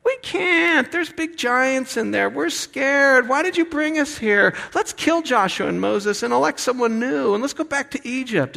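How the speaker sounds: high-pitched hammed-up cowardly whine; scared whining people. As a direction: squeak it like frightened complainers. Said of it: "they're like".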